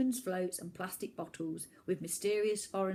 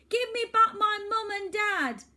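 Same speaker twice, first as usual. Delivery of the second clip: high, loud desperate shout; 14 semitones higher, 9 dB louder than usual. Acted. Boy shouts across the water for his parents, loud and high.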